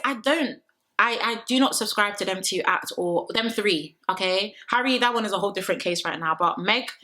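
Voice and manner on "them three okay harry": normal talking voice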